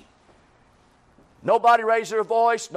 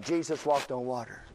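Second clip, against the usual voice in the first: mumbled while writing; 7 semitones lower, 7 dB quieter than usual. Retold or imitated. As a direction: lower and softer, repeating it to yourself. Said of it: "Okay, I'll, I'll write that down".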